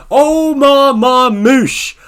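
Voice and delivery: long, loud, high hype call of the name "Omar Marmoush"; hype shout. Real, not acted. Fan cheering his player's name to viewers, loud and slow. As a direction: stretch out "Omar Marmoush" like a stadium announcer.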